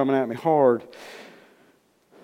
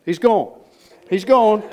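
acted outcry; 8 semitones higher, 7 dB louder, slower than usual. Acting family's cry that he's gone.

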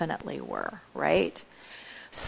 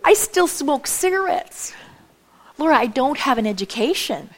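higher-pitched mock objection; imagined protesting listener; 4 semitones higher than usual. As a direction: play a doubter pleading excuses. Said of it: "so you're still thinking".

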